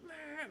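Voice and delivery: high, thin held wail; soft mock baby wail; imitated baby bawling. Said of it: "she's just blasting away".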